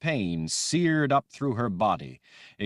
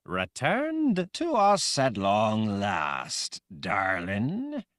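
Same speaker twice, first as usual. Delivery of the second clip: slow, possessed purr; voice only partly her own; 3 semitones higher, much slower than usual. A narrator's reading. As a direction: let another man speak through her. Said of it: "In a voice only partly her own".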